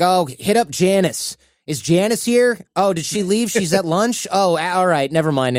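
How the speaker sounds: high-pitched office caller asking around; mock payment chaser. Someone hunting for Janice over an invoice, mock searching voice. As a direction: play a hopeful caller, then give up.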